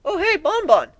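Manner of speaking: put-on very high, loud young dragon voice; little dragon calling at a door; eager Spike.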